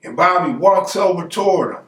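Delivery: ordinary talk, nothing marked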